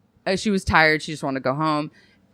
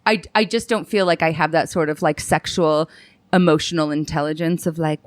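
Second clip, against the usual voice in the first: ordinary talk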